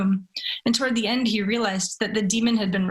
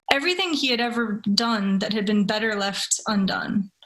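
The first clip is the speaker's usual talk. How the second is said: ordinary delivery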